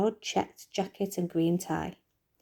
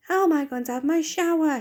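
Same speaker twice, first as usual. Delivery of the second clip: higher, louder demand; 8 semitones higher, 4 dB louder than usual. Acted. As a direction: insist on an answer, exasperated. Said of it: "he demanded".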